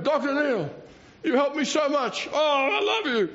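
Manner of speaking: a hint of an effusive admirer; gushing Greek fella. Grateful Con thanking the doctor, lightly voiced gush.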